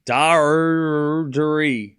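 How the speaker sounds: Dardiri with a hugely drawn-out DAR; exaggerated pronunciation. Shown as a demonstration. Practising a player's name for chat, exaggerated pronunciation. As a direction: stretch the first syllable of Dardiri very slowly.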